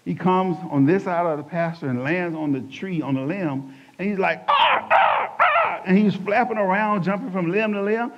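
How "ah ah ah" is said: loud, very high squawking caw; raven caw. Retold or imitated. Raven heckling the pastor, loud high caw. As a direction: screech it out like a crow on a branch. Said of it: "I hear this loud noise, uh, a raven".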